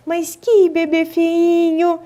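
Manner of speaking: high, slow singsong coo; playful baby talk. Acted. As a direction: tease a baby in a doting voice. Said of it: "entonação, é a voz".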